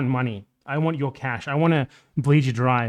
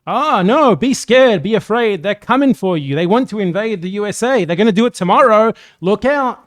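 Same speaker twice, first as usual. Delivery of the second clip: much higher, louder mock panic; hammed-up alarmist warning; alarmist fearmongering; 6 semitones higher, 6 dB louder than usual. Mocking fearmongering propaganda.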